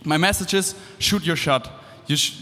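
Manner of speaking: ordinary talk, nothing marked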